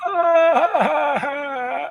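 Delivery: high loud mock wail; hammed-up toddler cry; imitated child wail. Imitating little Nicholas falling down crying, high mock wail. Boy falls to ground crying.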